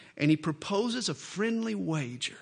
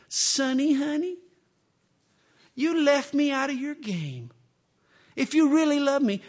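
much higher, coaxing mock wheedle; sweet-talking wife; 9 semitones higher, 4 dB louder than usual. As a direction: wheedle him like a manipulator. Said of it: "she's a manipulator".

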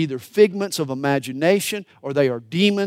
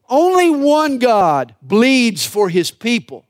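higher, louder and slow, each word weighed; a fervent preacher's swell; emphatic proclamation; 6 semitones higher, 6 dB louder, slower than usual. Real, not acted. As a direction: declare it with full conviction. Preacher climax on Christ's sacrifice.

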